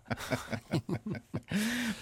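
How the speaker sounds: high breathy laugh. Real, not acted. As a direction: burst out laughing. Laughs at the quiz answer.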